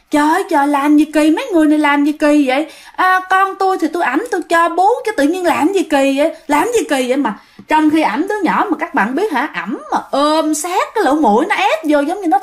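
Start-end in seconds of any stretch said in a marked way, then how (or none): 0.1-7.2 put-on cling to the baby and snap back at them, fast and high; eerie possessed protest. Possessed mother refuses to give baby.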